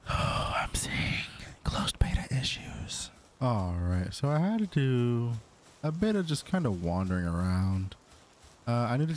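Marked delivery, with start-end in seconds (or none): 0.0-3.1 weary whisper. Real, not acted. Sighing at buggy game.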